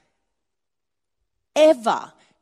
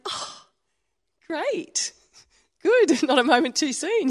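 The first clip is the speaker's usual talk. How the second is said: high, teasing "not a moment too soon"; cheeky relief; 7 semitones higher, slower than usual. Retold or imitated. Daughter hearing her parents will attend church, high and teasing. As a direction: rib the parents playfully. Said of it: "And I was like".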